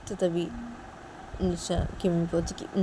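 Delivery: plain reading voice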